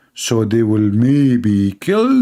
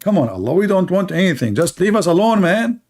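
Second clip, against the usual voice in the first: higher, fed-up begging to be left alone; whiny exasperation, rising to the end; exasperated plea; 5 semitones higher than usual. Retold or imitated. Martyrs begging Allah to leave them alone, exasperated.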